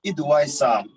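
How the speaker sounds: measured verse, a pause after every line; poetry recitation. Acted. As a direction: recite line by line, lingering on the phrase endings. Recites second poem.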